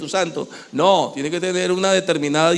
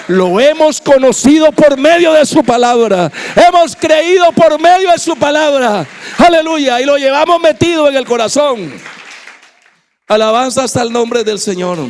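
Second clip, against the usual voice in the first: higher, loud, impassioned sermon delivery; fiery preaching; 9 semitones higher, 6 dB louder than usual. Acted. Preacher stirs the congregation, loud and impassioned. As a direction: preach it hard, voice raised to the rafters.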